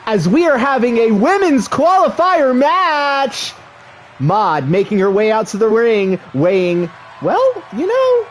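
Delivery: excited wrestling announcer; play-by-play commentary. Acted. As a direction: call the match like a ringside commentator.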